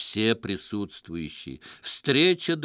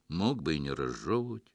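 satisfied purr; 7 semitones lower, 3 dB quieter than usual. A narrator's reading. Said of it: "удовлетворённо мурлыкнул".

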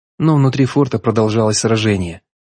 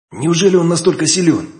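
lightly voiced stunned question, pitched up; stunned disbelief; 8 semitones higher than usual. A narrator's reading. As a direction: ask it in shocked disbelief. Hero's stunned inner thought.

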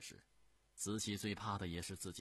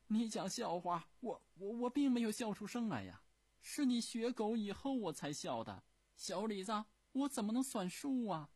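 戏谑口气; 8 dB louder than usual. Acted. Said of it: "用一种戏谑的口气".

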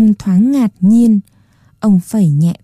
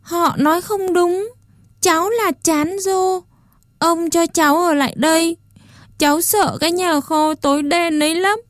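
put-on much higher, softer, tearful child; crying; 8 semitones higher, 5 dB quieter than usual. A narrator's reading. Puppet boy cries and begs to stay out of the shed. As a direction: speak through tears, pleading. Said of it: "Chán Rô khóc và nói".

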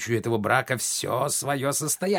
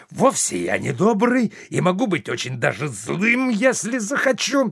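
angry protest that jumps much higher on «захочу»; in a temper; 6 semitones higher, 3 dB louder than usual. Acted. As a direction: snap back, rising into a cry. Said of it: "Орган в сердцах". (в сердцах, с возмущением)

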